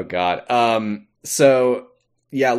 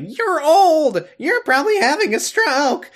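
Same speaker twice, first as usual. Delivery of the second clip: very high mocking heckle; heckler in the crowd; 13 semitones higher than usual. Smooth boy in the crowd taunting Dean, high heckler voice. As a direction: jeer in a high, put-on voice. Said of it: "a smooth boy in the crowd who's like".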